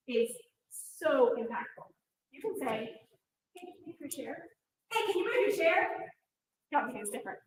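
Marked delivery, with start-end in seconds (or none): 3.5-4.5 quiet, gentle request; soft calm ask. Shown as a demonstration. Teacher to student, a soft gentle request. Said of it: "the tone of your voice". 4.9-6.1 higher, tense demanding request; sharp intense ask. Shown as a demonstration. Teacher to student, a sharp intense demand. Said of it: "the tone of your voice".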